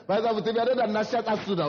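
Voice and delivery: normal talking voice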